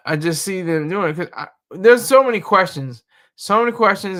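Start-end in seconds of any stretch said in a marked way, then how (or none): 1.7-3.0 exasperated. Real, not acted. Exasperated rant. 3.4-4.2 exasperated. Real, not acted. Exasperated rant.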